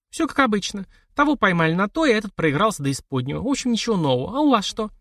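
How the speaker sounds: tossing off capital gossip lightly; lightly voiced society widow. Sister tells brother news, breezy high voice.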